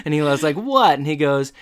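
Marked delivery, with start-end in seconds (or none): 0.5-1.0 high, loud startled yelp; startled what. Retold or imitated. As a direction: jump at being told off. Eli startled at being told off.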